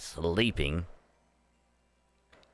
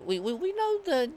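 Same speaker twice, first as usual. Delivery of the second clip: much higher, stammering squeak; squeaky plea. Pretend. Player admitting fault to the dragon, squeaky. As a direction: squeak it out like a scared kid.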